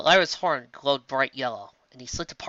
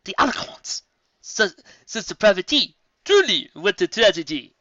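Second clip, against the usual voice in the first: shocked gasp; 6 semitones higher, 7 dB louder than usual. Acted. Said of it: "Zecora gasped".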